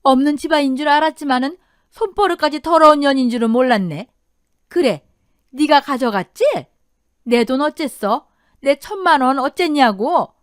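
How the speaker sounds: furious loud accusation; angry mother-in-law. Acted. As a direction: lay into her, loud and demanding. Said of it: "득달같이 달려들었다더군요".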